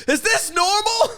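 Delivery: laughing quote. Retold or imitated. Recalling a confused heckler, cracking up. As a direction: laugh the whole question out. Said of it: "someone was like".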